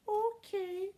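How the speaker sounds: put-on high, slow child voice; kid voice. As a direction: speak as a small boy. Ross kid voice.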